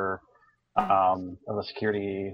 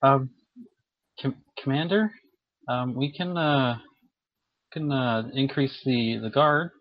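hesitant, higher-pitched sheepish ensign; timid suggestion with ums and restarts, pitched up; 5 semitones higher than usual. Acted. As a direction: approach the commander sheepishly, halting between words. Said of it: "he kinda sheepishly walks close to you".

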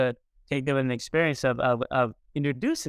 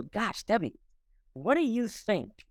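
wondering question; 7 semitones higher, 3 dB quieter, slower than usual. Retold or imitated. Quoting himself to Debbie.

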